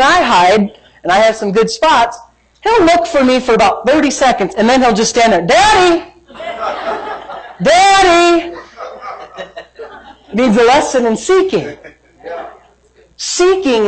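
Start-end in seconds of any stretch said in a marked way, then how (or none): 5.5-8.6 high, drawn-out call for Daddy; imitated kid's plaintive high call; child calling out. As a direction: holler like a little kid who gave up searching. Imitating son calling out for dad.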